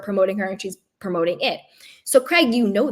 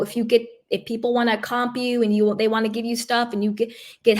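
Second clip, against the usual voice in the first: normal talking voice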